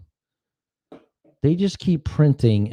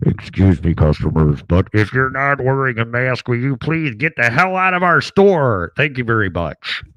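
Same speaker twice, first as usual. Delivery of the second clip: PA announcement; 4 dB louder than usual. Retold or imitated. Drugstore clerk telling unmasked customers to leave over the PA, loud. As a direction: announce it over the store speakers. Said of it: "over the P A came something like this".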